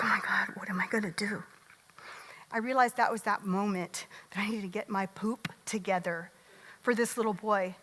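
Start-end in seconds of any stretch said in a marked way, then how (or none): 0.0-1.4 retold whisper, lost and hopeless; whispered panic to herself; despairing whisper. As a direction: breathe it out as if hiding behind hands. Retold despair, hands over face.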